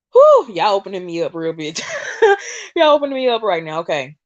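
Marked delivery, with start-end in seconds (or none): 1.8-2.7 a burst of high, surprised laughter; sudden high laugh. Real, not acted. Host laughing, overwhelmed while telling viewers they're opening her up. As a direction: laugh out, caught off guard by emotion. Overcome while channeling on live.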